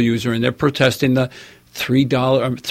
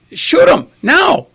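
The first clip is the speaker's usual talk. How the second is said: high, pleading exasperation; urgent outburst, much higher; exasperated inner shout; 12 semitones higher than usual. Retold or imitated. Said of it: "I'm thinking".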